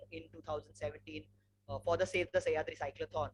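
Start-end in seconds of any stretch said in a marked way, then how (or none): none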